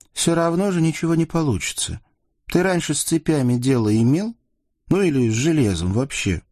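nagging doubt, pitch easing down; sceptical teenage girl. A narrator's reading. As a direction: question whether he has any idea. Ksenia doubts Yusuf's attempt.

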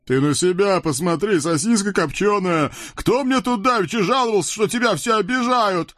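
high, cartoon devil voice scolding his friend; a chubby devil needling his buddy; devil Khryak. Толстый чёрт Хряк. (высокий мультяшный чёрт)

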